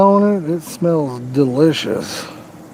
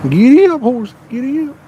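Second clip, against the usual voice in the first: lightly played, higher-pitched horse call; hint of cowboy; 7 semitones higher than usual. Joking gamer to a pretend horse, hint of cowboy. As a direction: urge on a pretend horse, starting high.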